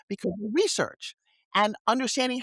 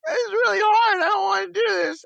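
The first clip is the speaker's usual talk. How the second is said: high, strained teary plea, louder; half-sobbing it out, pitch way up; replaying a tearful complaint from the car; retold crying; 11 semitones higher, 5 dB louder than usual. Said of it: "in my car crying going".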